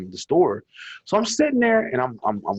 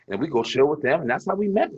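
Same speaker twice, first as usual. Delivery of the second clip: ordinary talk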